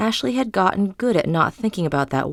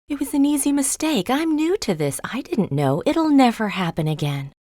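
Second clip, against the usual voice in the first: higher, hurried pleading voice at first; pleading excuses; 7 semitones higher than usual. A narrator's reading. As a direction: talk your way out of trouble. Maid pleading innocence to manager.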